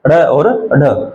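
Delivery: अड़ और अढ़ slow, with a short अ sound before each flap; teacher repeating the flapped pair slowly; flapped sounds demo. Shown as a demonstration. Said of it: "ए की ध्वनि पहले आएगी".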